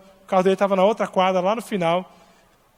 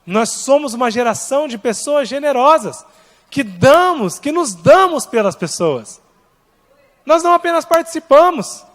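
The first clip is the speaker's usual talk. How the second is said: fiery preaching; 4 semitones higher than usual. Acted. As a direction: preach it with rising fire. Preaching on generosity.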